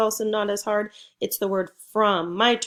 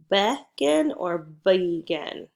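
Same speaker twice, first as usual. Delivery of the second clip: slow choice question; much slower than usual. Shown as a demonstration. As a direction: beckon and begin drawn out slowly, each syllable clear. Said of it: "Is it".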